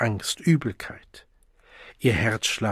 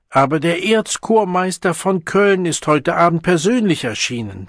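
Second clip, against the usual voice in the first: near tears; 6 semitones higher, 4 dB louder than usual. A narrator's reading. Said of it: "Siegmund weinte fast".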